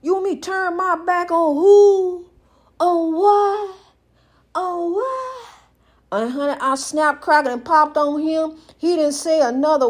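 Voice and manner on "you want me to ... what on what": acted-out indignant objection; incredulous retort. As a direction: question it higher and higher, stretching the last words. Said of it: "I said, "I, wait a minute".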